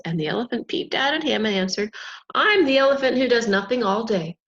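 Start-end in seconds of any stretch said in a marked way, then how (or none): 2.3-4.4 loud. Acted. Elephant answers proudly.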